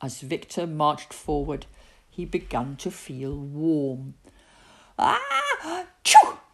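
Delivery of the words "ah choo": high gasp, explosive sneeze; big comic ah-choo; acted sneeze. A narrator's reading. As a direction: build up, then burst into a loud sneeze. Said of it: "he sneezed".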